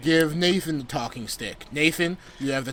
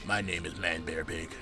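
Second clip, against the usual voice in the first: much lower and softer, a hushed deep monster voice; deep Man Bear Pig voice; 12 semitones lower, 15 dB quieter than usual. Pretend. Playing Man Bear Pig in a mock interview, low and hushed. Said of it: "voice so deep?".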